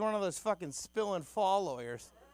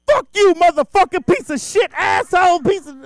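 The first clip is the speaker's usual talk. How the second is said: much higher and louder raging curse; furious shout; 13 semitones higher, 17 dB louder than usual. Retold or imitated. Homeless guy cussing out the comedian who refused money, furious shout. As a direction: explode at him at full volume. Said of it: "he goes nuts on me".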